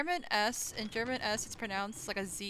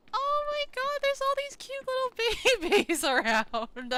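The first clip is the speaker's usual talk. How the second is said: gushing, much higher squeal over the cute babies; squealing excitement; 6 dB louder than usual. Real, not acted. Streamer sees tiny babies in the game, squealing with delight. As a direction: coo like you just spotted puppies.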